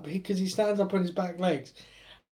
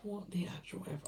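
whispering the curse in disbelief; whispered disbelief; 2 semitones higher, 14 dB quieter than usual. Real, not acted. Repeating his disbelief to himself in a whisper. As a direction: hush it, stunned.

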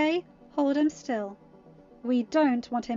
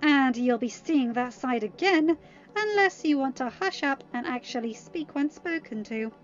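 louder, growled warning; growled threat; 4 semitones higher, 6 dB louder than usual. A narrator's reading. Mal threatening the captive, mildly growled. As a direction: warn with a touch of a growl. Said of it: "Mal growled".

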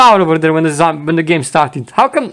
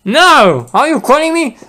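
outraged disbelief; 8 semitones higher, slower than usual. Acted. Offended by insult.

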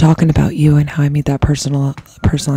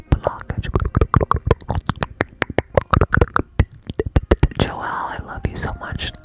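ear cleaning asmr; 3 semitones higher than usual. Real, not acted.

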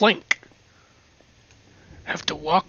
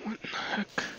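normal talking voice

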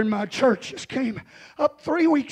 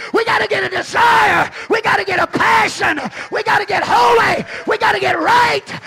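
much higher, much louder, chanted "we gotta" lines; peak preaching shout; 10 semitones higher, 9 dB louder than usual. Acted. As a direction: drive each line home to the crowd. Preaching climax, repeated lines.

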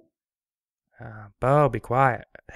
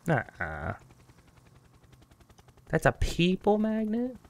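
ordinary talk

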